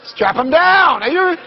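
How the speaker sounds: usual speaking voice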